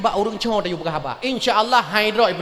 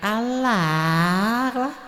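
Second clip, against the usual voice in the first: put-on young girl takbir; 3 dB quieter, much slower than usual. Shown as a demonstration. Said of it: "Anak dara likut".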